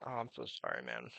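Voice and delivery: ordinary talk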